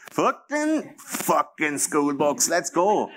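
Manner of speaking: high, slow, put-on teen voice; mock teenage self. Recalling his school walkout, a mock bratty teen. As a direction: play your bratty teen self, drawn out. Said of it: "I was all like".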